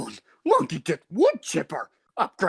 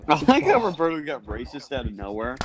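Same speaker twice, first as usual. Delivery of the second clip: normal talking voice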